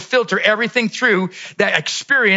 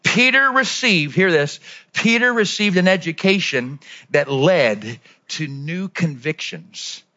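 slow dictation; 3 semitones lower, slower than usual. Shown as a demonstration. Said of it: "I want you to write this down".

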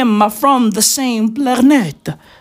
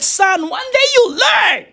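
wise father; 11 semitones higher than usual. Retold or imitated. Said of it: "And I'm like".

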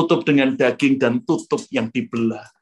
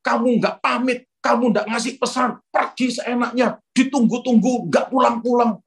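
higher, nagging reproach; mean scolding; 7 semitones higher than usual. Retold or imitated. Spouse scolding someone who left without a word, mean and high. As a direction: imitate a nasty telling-off. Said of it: "Wah, ngomongnya jahat".

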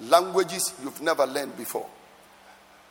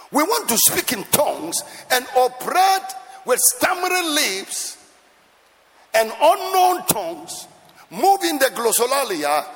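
raised-voice rebuke to the congregation; impassioned preaching; 8 semitones higher, 4 dB louder than usual. Real, not acted. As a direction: preach it at full force, phrase by phrase. Preaches at full force.

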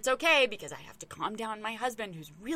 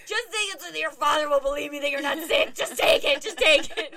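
higher, louder, desperate insisting; retold pleading yell; 5 semitones higher, 7 dB louder than usual. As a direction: beg the kid to take it, half yelling. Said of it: "screaming at him".

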